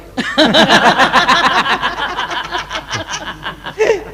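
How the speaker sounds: long high laugh ending the bit. Real, not acted. Comedian to audience, laughing at the final punchline. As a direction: laugh out the finish.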